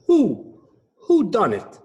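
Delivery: hooting owl detective; owl hooting who. Acted. Detective owl hooting to himself. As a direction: stretch the who like an owl's hoot. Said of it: "Hubert cried".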